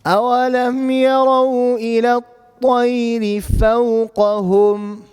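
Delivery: melodic Quran recitation; recited verse. Shown as a demonstration. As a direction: chant the verse with tajweed. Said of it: "teacher recites full verse".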